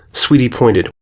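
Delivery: plain reading voice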